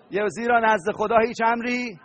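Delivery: ordinary delivery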